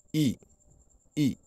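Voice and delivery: I falling from high, twice; two short falling I sounds; fourth and fifth tones. Shown as a demonstration. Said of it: "le quatrième".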